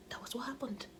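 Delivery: soft whispered aside. Real, not acted. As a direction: breathe it out as an aside.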